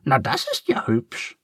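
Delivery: very high, squeaky little duck, curious; a cheerful duck asking kindly. A narrator's reading. Duck replies.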